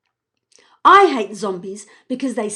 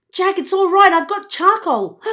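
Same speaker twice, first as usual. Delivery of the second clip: higher, louder reassurance; calling out; 9 semitones higher, 7 dB louder than usual. Acted. Evie calling to Jack, reassuring loudly. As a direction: call out to calm him.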